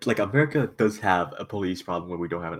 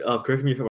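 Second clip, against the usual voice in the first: cut off suddenly; much faster than usual. Real, not acted. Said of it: "You're cutting out".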